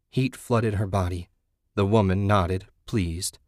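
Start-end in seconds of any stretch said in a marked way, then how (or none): none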